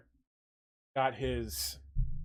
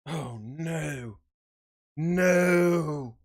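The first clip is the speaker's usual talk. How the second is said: a real pained "no", rising to a shout; sudden distressed outburst; dismayed cry; 3 semitones higher, 5 dB louder, much slower than usual. Real, not acted. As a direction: cry out as the piece gets stuck. Resin stuck, reacts in dismay.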